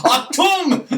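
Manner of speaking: Achtung! barked in a loud, high mock German; hammed-up German Achtung!; German shout. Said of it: "he had a real thick German accent".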